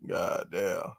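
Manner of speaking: usual speaking voice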